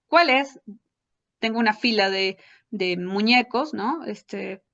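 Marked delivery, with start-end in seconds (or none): 0.1-0.6 put-on asking the child. Shown as a demonstration. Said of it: "hago el sonido y le digo".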